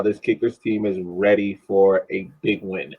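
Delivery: usual speaking voice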